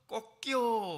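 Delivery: high, loud crowing rooster cry. Retold or imitated. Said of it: "하고 닭이 우는 거예요". (높고 크게 닭 울음소리 흉내)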